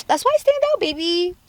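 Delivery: high, put-on diva voice; sassy bragging. Vlogger showing off to viewers in a high sassy voice. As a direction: flaunt it like a diva.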